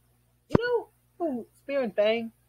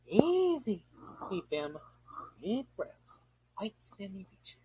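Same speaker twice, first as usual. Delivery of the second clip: much softer, breathing it out to himself; calming himself down; 10 semitones lower, 9 dB quieter, slower than usual. Acted. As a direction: talk yourself down from the rage, hushed. Calming himself after anger outburst.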